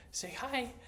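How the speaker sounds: high soft pet voice; talking to a dog. Real, not acted. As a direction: coax the dog sweetly.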